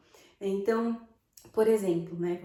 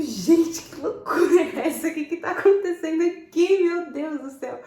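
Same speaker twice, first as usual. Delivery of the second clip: loud, high, laughing disbelief; shocked disbelief; 6 semitones higher, 8 dB louder than usual. Retold or imitated. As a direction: react in stunned amusement at the chaos. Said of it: "Eu ficava tipo". (espanto alto e rindo)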